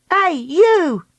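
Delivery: high-pitched calling shout; high storybook heroine shouting. A narrator's reading. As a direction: call out sharply to get his attention. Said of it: "Dakota shouted".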